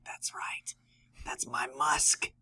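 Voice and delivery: smug unvoiced whisper. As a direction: gloat in a whisper. Gloating at smelly Valentine.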